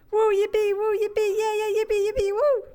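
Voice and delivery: high, loud yippee squeal; playful cheering. Pretend. Streamer whooping to themselves, high giddy cheer.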